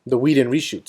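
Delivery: ordinary talk, nothing marked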